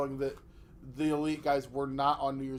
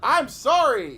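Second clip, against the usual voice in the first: loud, high sorry; loud apology; 8 semitones higher, 11 dB louder, slower than usual. Real, not acted. Caught talking into hand.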